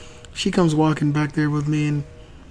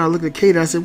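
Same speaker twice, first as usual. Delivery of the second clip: ordinary delivery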